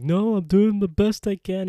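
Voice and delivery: high, loud, tearful protest; hammed-up wail of self-defence; mock crying. Eldest son pleading with his father's spirit, mock crying. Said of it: "starts crying".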